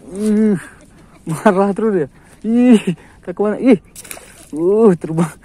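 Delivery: loud cries as the fish breaks free; loud, alarmed exclaiming; excited shouts. Real, not acted. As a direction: yell out in alarm and thrill. Fish escapes and flies up.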